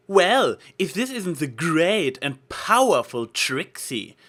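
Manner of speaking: loud, high, slow mock announcement; mocking jock. A narrator's reading. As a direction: tease her like a brash sports star. Rainbow Dash mock-greets Trixie.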